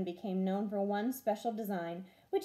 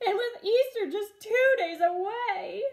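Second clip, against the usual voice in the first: higher and louder, a tearful cry; weeping mother; 10 semitones higher, 6 dB louder than usual. Acted. Mother frets about Easter through tears. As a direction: still sobbing, plead with the air. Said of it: "His mother wept and wrung her hands".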